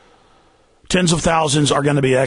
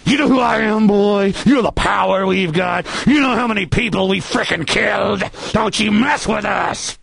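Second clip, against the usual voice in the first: higher, hammed-up threatening voice; unmasked menacing official; 4 semitones higher than usual. Host showing who officials really are, menacing bully voice. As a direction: threaten hard, stretching the final warning. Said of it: "Let me show you who they really are".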